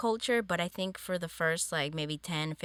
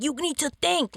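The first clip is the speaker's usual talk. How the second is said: a sharp, higher teacher's scold, jabbing a finger; stern teacher; 10 semitones higher, 4 dB louder than usual. Retold or imitated. Teacher scolding a third grader, sharp and raised. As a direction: snap it at the kid like an angry teacher. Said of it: "like that".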